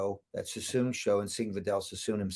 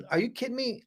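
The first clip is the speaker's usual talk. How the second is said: retold high-pitched disbelief; mock incredulity; 11 semitones higher, 3 dB quieter, faster than usual. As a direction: act out an amazed evaluator, much higher. Evaluator amazed he asks about coaching.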